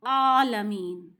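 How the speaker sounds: आलमीन slow with आ from the throat; throaty आलमीन. Shown as a demonstration. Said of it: "आ, आ गले से".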